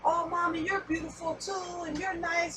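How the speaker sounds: lightly imitated sweet talk from early dating; sweet-talking boyfriend. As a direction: coo it like a man charming his girl. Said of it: "He used to say".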